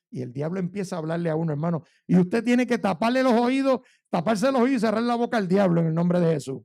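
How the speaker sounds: ordinary talk, nothing marked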